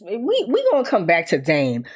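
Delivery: usual speaking voice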